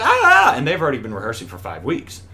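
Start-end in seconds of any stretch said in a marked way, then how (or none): 0.0-0.5 short, very high and loud panicked shriek; panicked scream. Retold or imitated. Newcomer handed the script with three days left, panicked shriek. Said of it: "And I'm like".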